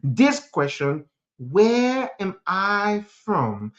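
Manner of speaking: normal talking voice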